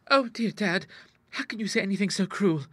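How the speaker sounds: high, hurt plea; daughter's plaintive cry, pitched high; pleading cry. A narrator's reading. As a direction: coax him with wounded pleading. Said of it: "cried Ida".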